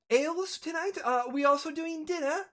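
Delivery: high-pitched polite questioning; female server. Acted. Server asking guests about ales and dinner, high voice. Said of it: "female comes up".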